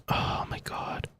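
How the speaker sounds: slow, soft exasperated whisper. Real, not acted. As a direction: breathe it out, overwhelmed. Overwhelmed by locked doors.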